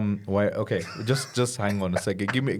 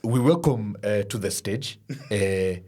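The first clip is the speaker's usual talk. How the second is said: put-on Ugandan host accent. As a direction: introduce the guest like a Ugandan host. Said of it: "and his accent".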